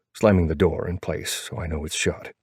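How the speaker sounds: plain reading voice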